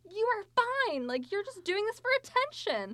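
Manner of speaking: high, loud, hammed-up accusation; mock outrage. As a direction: shriek the accusation in disbelief. Retold outburst at the old lady.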